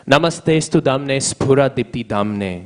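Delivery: slow, measured Sanskrit recitation; verse recitation. Real, not acted. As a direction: recite the verse slowly, stretching the syllables.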